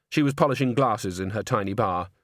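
usual speaking voice

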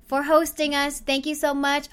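ordinary talk

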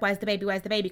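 ordinary talk

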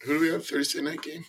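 ordinary talk

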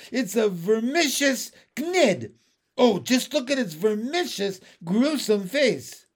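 high, loud horror, slowing at the end; horrified. A narrator's reading. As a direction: recoil from a gruesome monster. Police chief horrified by the creature.

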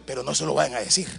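dropped low and soft, like sharing a secret; secret aside. Acted. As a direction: hush it to the congregation, conspiratorially. (en voz baja y cómplice, como un secreto)

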